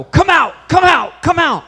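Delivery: high, very loud repeated shout, re-enacting an exorcism; imitated shouted command. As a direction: bellow it as a mock exorcist's order.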